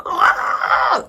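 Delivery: high-pitched drawn-out "ah" of pain; reenacted high wail of pain; pained cry. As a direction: mimic crying out at the needle. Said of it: "that needle hurt so bad".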